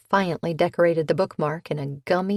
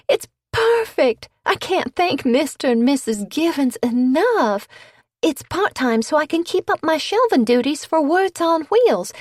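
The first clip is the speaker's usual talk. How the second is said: much higher, louder thrilled voice; bright excitement; 9 semitones higher, 5 dB louder than usual. A narrator's reading. Leanna to Cleo, thrilled about the new job and much higher. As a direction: bubble over with joy. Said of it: "she brightened".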